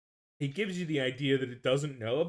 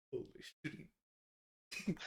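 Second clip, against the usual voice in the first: whispered; 15 dB quieter, much slower than usual. Real, not acted. Stunned reaction to reveal.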